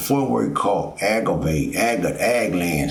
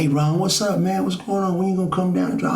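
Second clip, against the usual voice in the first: much higher put-on voice of a buddy on the phone; imitating the guys calling; 11 semitones higher than usual. Jail buddies phoning him in the morning, high mock voice. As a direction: mimic the inmates calling, pitched well up. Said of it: "And they say".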